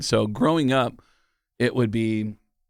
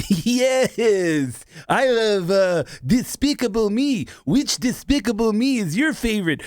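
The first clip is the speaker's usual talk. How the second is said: put-on much higher, excited young woman's voice; Dylan from Love on the Spectrum; 10 semitones higher, slower than usual. Interviewer asks Dylan her favorite movie, high eager voice. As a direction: gush like Dylan about her favorite film. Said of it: "I'll answer it as Dylan from Love on the Spectrum".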